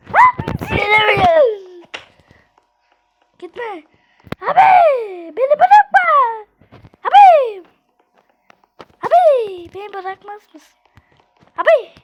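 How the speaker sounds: high, loud calls after a leaving friend; real pleading calls; desperate pleading calls. Real, not acted. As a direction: plead don't leave me. Begging teammate not to leave.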